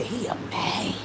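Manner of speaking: put-on whispered little-girl voice; mother imitates her little daughter's whisper; child's whisper. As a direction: breathe it like a small child. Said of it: "That's how she would talk".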